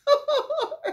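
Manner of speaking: high-pitched laugh; amused laugh. Real, not acted. Storyteller recalling a nightmare guest, laughing. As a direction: burst of real laughter at one's own tale.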